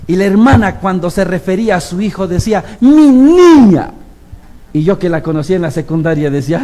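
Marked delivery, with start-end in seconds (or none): 2.8-3.9 high, loud, very slow and drawn out; doting mother. Retold or imitated. Mother fondly calling her daughter, drawn out and loud. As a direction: coo it like a proud mother.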